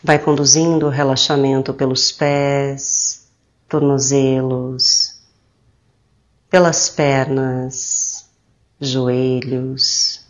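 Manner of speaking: slow, soothing meditation voice with drawn-out phrase endings; calm, slow guidance with long pauses; guided meditation. Acted. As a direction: guide them gently into relaxation, unhurried.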